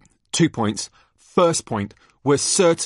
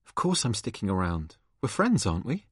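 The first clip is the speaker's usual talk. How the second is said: soft; 3 semitones lower, 6 dB quieter than usual. Acted. Quiet exchange about friendship.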